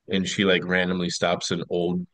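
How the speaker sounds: ordinary talk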